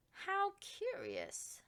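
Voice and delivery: high, slow musing; drawn-out wonder at the odd leaves; curious. A narrator's reading. Rebecca Estelle eyeing strange leaves, slow and high. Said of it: "she said".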